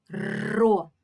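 Ро with a long trilled р; stretched trill at the start of Ро; drawn-out sound. Shown as a demonstration. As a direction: roll the р hard before releasing Ро. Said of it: "Починається звука р".

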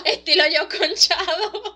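real laughter through the words; laughing. Real, not acted. Host laughing as she compares herself with Shadow, laughing loudly. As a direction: laugh it out, slow and loud.

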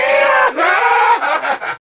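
high screaming villain laugh; maniacal laugh. Acted. Villain at the height of battle, maniacal screamed laugh. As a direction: let out a wild shrieking laugh.

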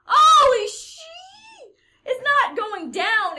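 high laughing shriek, drawn out; shrieking surprise. Real, not acted. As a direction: scream it in shocked laughter. Trap surprise while playing.

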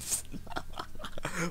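breathy high giggle fit; unvoiced giggling burst. Real, not acted. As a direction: giggle helplessly at the screen. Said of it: "Why am I laughing now?".

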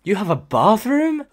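mocking her question. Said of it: "did she just interrupt and go".

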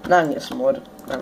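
ordinary delivery

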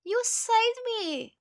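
high, excited storybook exclamation; cried out. A narrator's reading. Rescued boy to the turtle, cried out. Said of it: "cried the boy".

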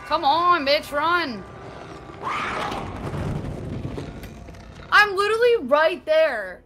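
loud high frantic urging; urgent yelling. Real, not acted. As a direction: panic and yell at it. Fleeing monster, urging character.

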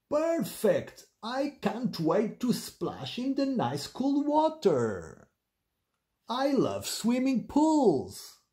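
high, slow, gleeful storybook pig; children's-story pig voice, high and thrilled; excited Penelope the pig. Acted. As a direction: draw it out with delighted anticipation.

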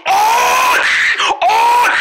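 high, loud held scream of shock. Acted. Patient gets ice put on his head, shocked scream. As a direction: shriek at sudden cold.

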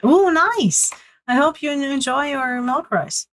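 high-pitched, delighted cheer; pleased for a friend, voice lifted high; delighted reaction. Real, not acted. Reacting happily to viewer's food.

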